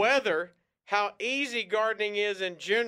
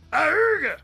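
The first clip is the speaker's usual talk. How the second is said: loud high honk; horn honk imitation; 8 semitones higher, 8 dB louder than usual. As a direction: squawk like a tiny car horn. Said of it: "horns sound like this".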